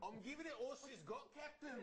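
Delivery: Scottish accent attempt. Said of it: "But Australian".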